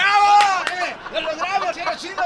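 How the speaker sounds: high, loud triumphant cheer; cheering victory. Acted. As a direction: celebrate winning the case. Lawyer celebrates verdict.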